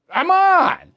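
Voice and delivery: high, loud, exasperated come on; scoffing disbelief. Real, not acted. Host scoffing at a bogus poll, exasperated and loud.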